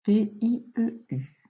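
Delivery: slow, separated spelling; letter by letter. Shown as a demonstration. Teacher correcting a pupil's dictation, letter by letter. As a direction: spell it out with gaps between letters.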